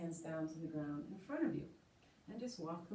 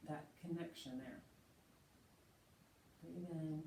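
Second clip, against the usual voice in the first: guided meditation; 8 dB quieter than usual. Acted. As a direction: settle them quietly into stillness. Leads seated meditation.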